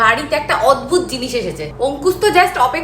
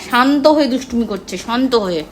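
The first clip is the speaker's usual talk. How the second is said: ordinary talk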